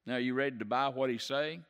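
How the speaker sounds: usual speaking voice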